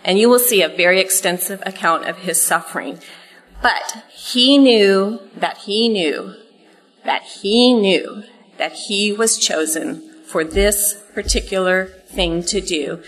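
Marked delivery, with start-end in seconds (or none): 3.6-10.0 each "he knew" slow and weighted; slow, deliberate preaching emphasis; emphatic repetition. Acted. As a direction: drive home every "knew", very slowly. Emphatic sermon climax.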